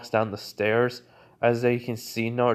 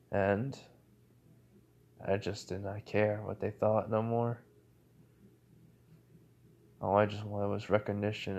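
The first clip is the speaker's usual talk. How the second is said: sad confession; 2 semitones lower, 6 dB quieter than usual. Acted. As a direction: let the hurt pull the voice down. Naruto confides his lonely past.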